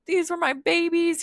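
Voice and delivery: high, wailing mock despair; freaking out. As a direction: squeal it like a distraught parent. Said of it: "Prometheus is freaking out".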